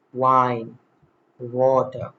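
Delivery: "Wine" and "Water" begun with rounded lips; W sound. Shown as a demonstration. Teacher showing W, carefully articulated. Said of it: "round lips into an O".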